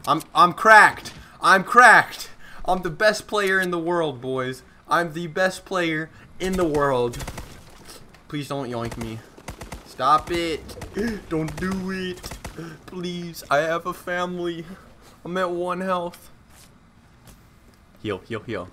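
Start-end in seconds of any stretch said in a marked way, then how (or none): 0.1-2.3 loud, high hype yell; hyped shout. Real, not acted. Hyped boasting mid-fight. 2.7-7.2 cocky, higher show-off voice; mock bragging. Mock boasting. 8.3-16.3 high whiny begging; mock pleading. Pleading with enemy, jokey.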